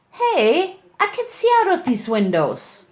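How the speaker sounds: put-on high, eager child voice crying out; a young filly protesting to her big brother, high and eager; excited little filly. A narrator's reading. Little Winter cries out to Lancer, high and childlike. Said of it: "cried Winter".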